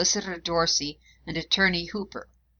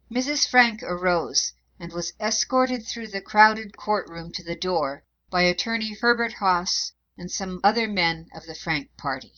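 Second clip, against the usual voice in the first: usual speaking voice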